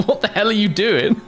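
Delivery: high pitched, laughing through the words; cracking up at a viewer's gaming marathon; laughing disbelief. Real, not acted. Streamer laughing at a chatter's thirty-hour binge.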